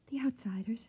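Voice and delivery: whispered fearful word; wife names the outsiders to husband, whispered; hushed. Acted.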